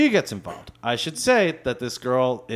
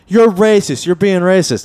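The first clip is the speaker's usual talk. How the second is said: loud, high mock accusation; raised-voice indignant imitation; retold yelling; 5 semitones higher, 5 dB louder than usual. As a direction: yell the accusation, imitating her. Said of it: "she starts yelling at the".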